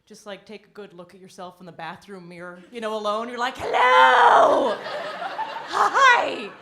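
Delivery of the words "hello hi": high, loud squeals with drawn-out words; drunken self-admiration. Acted. Drunk woman greeting herself in the bathroom mirror, squealing adoration. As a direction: gush loudly and high at your own reflection. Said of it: "go home after a night of drinking".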